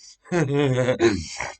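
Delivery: loud burst of laughter; big, loud chuckling laugh. Real, not acted. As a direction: laugh hard at own scenario. Laughs after the acted arrest.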